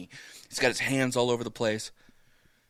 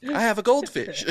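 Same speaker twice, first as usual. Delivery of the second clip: excited repeat; 12 semitones higher, 5 dB louder than usual. Retold or imitated. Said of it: "That's the greatest fucking pickup line ever".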